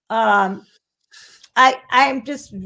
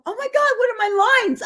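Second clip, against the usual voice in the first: panicked; 11 semitones higher than usual. Retold or imitated. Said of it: "And I went".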